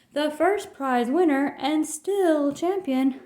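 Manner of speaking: slow, grand announcer read; hammed-up contest announcer; announcement. A narrator's reading. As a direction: proclaim the winner to the crowd. Judges announce winner.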